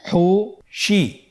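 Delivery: "হুশি" very slowly in two separate syllables; syllables apart. Shown as a demonstration. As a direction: split "হুশি" with a clear pause between syllables. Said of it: "এভাবেও পড়তে পারেন". ("হুশি" ভেঙে ভেঙে ধীরে)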